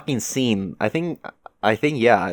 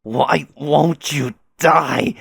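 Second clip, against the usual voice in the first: loud, higher, very slow cry; anguished fury; 4 semitones higher, 6 dB louder, much slower than usual. Acted. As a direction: hurl each drawn-out word at an enemy. Acted climax of a fight.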